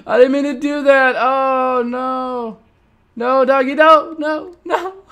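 high, loud half-laughing wail; laughing in dismay. Real, not acted. Gamer laughing through grief at his dead dog. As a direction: laugh and moan at once.

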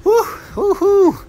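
short high excited oohs; excited whoops. Real, not acted. Gamer reacting to the fight, high excited whoops. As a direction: whoop high in quick bursts.

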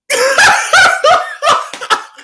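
high, loud burst of laughter; a real disbelieving laugh; shocked laugh. Real, not acted. Shock at the bill.

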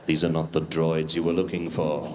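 deep solemn mind-trick line; Obi-Wan impression. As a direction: intone like a Jedi master. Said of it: "Jedi mind trick".

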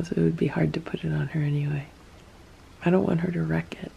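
quick soft whisper near a resting cat; rapid hushed whisper; fast whisper. Real, not acted. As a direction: hurry it out under your breath. Cat lying down nearby.

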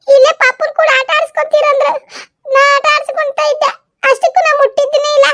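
high, whining plea of innocence; high, nervous young woman denying blame; whiny young woman. Acted. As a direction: protest sweetly that you did nothing.

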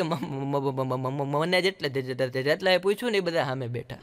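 a stammerer explaining why everyone sat opposite; stammering. Retold or imitated. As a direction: trip on every first sound.

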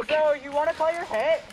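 loud, high call across the field; shouted callout. Real, not acted. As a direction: yell it out to a teammate. Teammate calling out in game.

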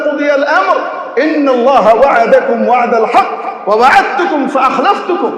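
slow chanted recitation; Quran recitation. Acted. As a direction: intone the verse slowly. (ধীর কুরআন তিলাওয়াত)

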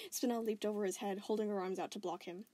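ordinary delivery, nothing marked

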